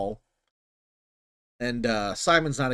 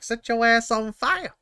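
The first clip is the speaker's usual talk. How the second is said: much higher, laughing villain taunt; Simon Phoenix impression; 9 semitones higher than usual. Villain taunting the cop before lighting the fire, laughing. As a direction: cackle the threat at him. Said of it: "my best Simon Phoenix".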